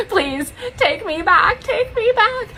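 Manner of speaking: high, slow whiny plea; pleading Stassi Schroeder. Retold or imitated. Woman imitating a reality star begging to return, high whiny plea. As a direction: beg like a reality star crawling home. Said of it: "where she's like".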